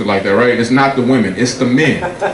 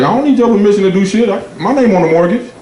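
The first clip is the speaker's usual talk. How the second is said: high, defiant husband snapping back; hammed-up marital argument; mock angry retort; 9 semitones higher than usual. Husband to his wife in the bedroom, high defiant retort.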